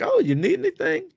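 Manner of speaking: very high-pitched, suddenly attentive server imitation; mock eager bartender. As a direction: mimic a bartender who just lit up. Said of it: "she fucking lit up".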